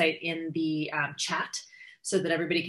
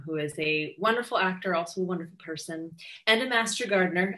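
ordinary talk

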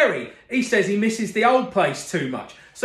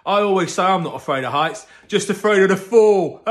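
usual speaking voice

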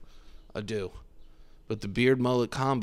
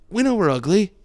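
loud; 10 semitones higher, 7 dB louder than usual. Real, not acted. Comic outburst, much higher, louder.

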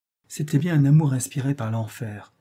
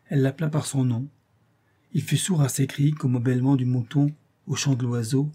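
plain reading voice

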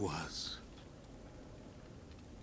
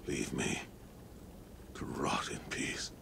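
bitter whisper; 6 semitones lower, 6 dB quieter than usual. Acted. Confessing bitter wish.

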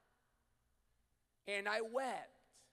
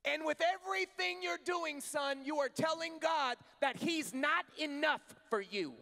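loud, higher preaching push to the congregation; full-force preaching; 8 semitones higher, 7 dB louder, slower than usual. Acted. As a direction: preach it out, urging them to stop running. Preaching continues.

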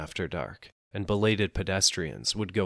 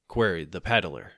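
ordinary delivery, nothing marked

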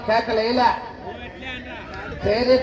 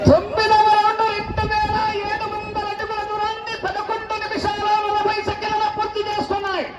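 high-pitched round announcement; sustained high commentary chant; race announcer; 11 semitones higher than usual. Real, not acted. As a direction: call the round result high over the crowd.

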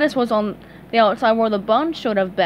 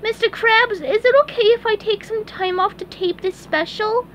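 very high, eager cartoon voice; asking the boss nicely; squeaky hopeful fry cook; pleading sponge; 11 semitones higher than usual. A narrator's reading.

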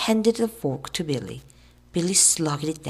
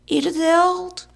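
high, slow, loud falsetto; drawn-out shrill teasing; yelled girlish taunt; 11 semitones higher, 6 dB louder, slower than usual. A narrator's reading. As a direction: yell it in a mock-girlish voice. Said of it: "yelled Billy".

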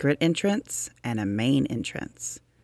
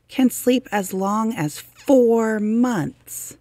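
slow and higher, stretching four months; drawn-out wonder; 10 semitones higher, 4 dB louder, much slower than usual. A narrator's reading. Reading to children, marvelling at how long woodchucks sleep. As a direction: make the length sound amazing.

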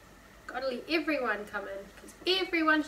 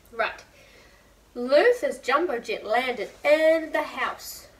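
ordinary delivery

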